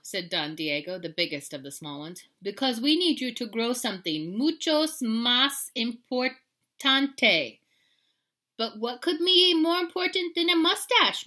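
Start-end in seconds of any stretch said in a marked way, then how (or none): 2.4-7.6 loud, higher, slow dramatic pronouncement; Don Diego. A narrator's reading. Biggest small dog instructs the hero, slow and grand. 8.6-11.3 high, loud, astonished asking; incredulous question. A narrator's reading. Hero quizzes his muchachos, loud and incredulous.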